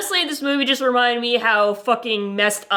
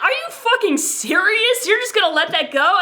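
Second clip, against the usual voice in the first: outraged; 8 semitones higher than usual. Retold or imitated. Her own outraged reaction retold.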